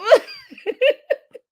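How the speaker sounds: loud, high-pitched laughing. Real, not acted. Laughs at own joke.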